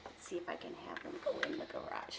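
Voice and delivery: fast whisper to herself while arranging toys; whispered aside. Real, not acted. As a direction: whisper it quickly under your breath.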